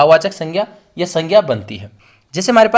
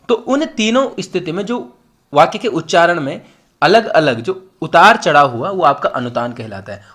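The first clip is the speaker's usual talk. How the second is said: normal talking voice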